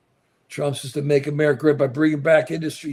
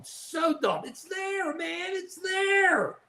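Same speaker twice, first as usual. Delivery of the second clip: high, strained cry of frustration; exasperated wail; 12 semitones higher, 4 dB quieter, much slower than usual. Real, not acted. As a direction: voice shooting up high in disbelief. Exasperated climax of solar rant.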